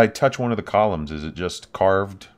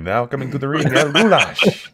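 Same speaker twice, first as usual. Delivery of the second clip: loud, higher wrestling ring announcer; 5 semitones higher, 7 dB louder than usual. Pretend. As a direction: boom it out like a ring intro. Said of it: "that sounds like a luchador's name".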